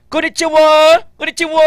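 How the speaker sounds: high, lilting "konnichiwa"; Japanese greeting. Shown as a demonstration. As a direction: sing out "konnichiwa". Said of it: "Sa Japan naman".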